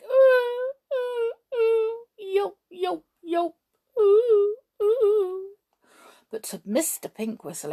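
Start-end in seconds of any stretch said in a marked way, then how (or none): 0.0-5.5 high-pitched drawn-out whines, short yelps; dog whines and yelps. A narrator's reading. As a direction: imitate a pitiful dog whimpering. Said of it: "it might have sounded like".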